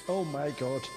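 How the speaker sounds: normal talking voice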